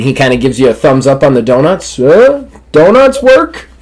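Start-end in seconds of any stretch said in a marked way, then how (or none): 2.0-3.7 much higher, louder, slow curious voice; Hank's voice. Retold or imitated. Orderly Hank asking if the donuts worked, high and slow. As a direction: ask it like a goofy friend.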